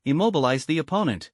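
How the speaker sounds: ordinary delivery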